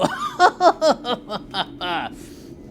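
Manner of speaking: loud mocking laugh from the class boys; boys' mocking laugh. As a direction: guffaw like the boys watching.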